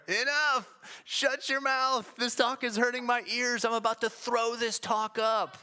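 high-pitched mock protest; imitated heckler's yell. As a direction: call out like a fed-up listener, drawing out the words. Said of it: "they'll yell from anywhere".

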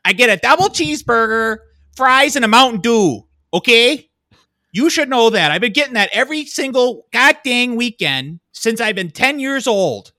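loud, very high hammed-up outrage; exaggerated indignant customer tirade; irate regular customer. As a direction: rant like an entitled regular at the counter. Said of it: "some dumbass coming in there going like".

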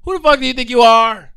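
loud, high, slow shouting at the coworker; mock furious yell. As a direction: blast each word like an outraged rant. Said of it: "I'm like".

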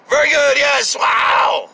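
high, loud fake praise; hammed-up applause shout; mock crowd cheer.

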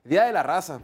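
loud, high-energy host welcome; booming show opener. Acted. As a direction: open the show big and bright for the crowd.

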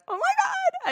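very high starstruck squeal; excited squeal. Retold or imitated. Spotting Nick Viall on Melrose, a very high excited squeal. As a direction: squeak it out like you just spotted a celebrity. Star-struck on seeing a celebrity.

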